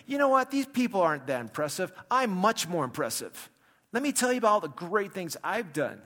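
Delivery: loud, high-pitched boastful claim; puffed-up show-off, pushed loud and high; mock bragging. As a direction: crow about your own greatness. Imagines Paul bragging about himself.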